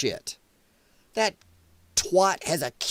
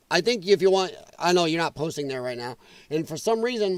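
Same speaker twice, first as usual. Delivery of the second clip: ordinary talk, nothing marked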